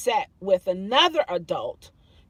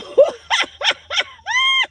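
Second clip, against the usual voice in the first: shrieking burst of laughter; loud high laugh; 7 dB louder than usual. Real, not acted. Laughing at her own doubt.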